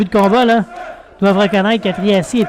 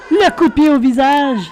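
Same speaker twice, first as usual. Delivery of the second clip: excited; 5 semitones higher than usual. Real, not acted. Excited call of a kick.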